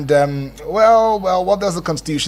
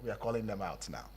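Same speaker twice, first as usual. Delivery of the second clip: ordinary talk, nothing marked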